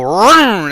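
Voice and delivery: short, loud mock growl; mock beast growl. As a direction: growl like a beast transforming, half-joking. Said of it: "I go".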